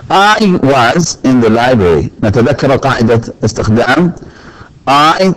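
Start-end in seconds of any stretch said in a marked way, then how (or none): 0.1-2.1 slow, careful reading of the example; slow example sentence. Shown as a demonstration. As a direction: say it slowly for the class. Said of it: "نغيرها تكون was".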